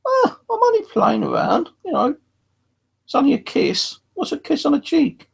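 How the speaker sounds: put-on old man protesting innocence. Dad brushing off his wife's scolding, high mock old man voice. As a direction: squeak out a cheeky old man's excuse. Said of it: "he just turned around and goes".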